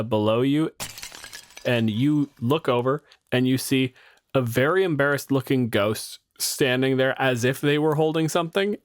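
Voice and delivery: ordinary talk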